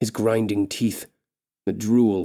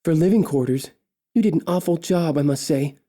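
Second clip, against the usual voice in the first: nippy retort; 6 semitones higher than usual. Acted. Elf mocking the orc king's home, high and snippy. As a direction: pitch it up and snip the words. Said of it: "Solana says nippily".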